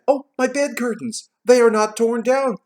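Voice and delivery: high, overjoyed cry of relief; excited cry. A narrator's reading. Scrooge finding his bed curtains, crying out joyfully. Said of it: "cried Scrooge".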